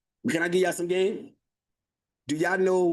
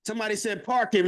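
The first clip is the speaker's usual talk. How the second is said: ordinary talk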